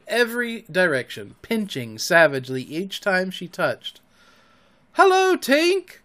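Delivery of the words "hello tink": very high, loud drawn-out greeting; high, slow singing-out hello; wondering cry. A narrator's reading. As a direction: hail her in wonder, pitched way up. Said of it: "cried the wondering boys".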